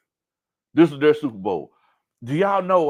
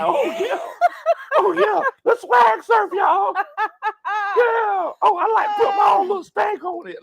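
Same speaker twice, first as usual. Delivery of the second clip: high, hyped-up dancer's whooping; mock party hype; 4 dB louder, slower than usual. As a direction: play a reveler cheering on the dance. Acting out parade dancer's hype.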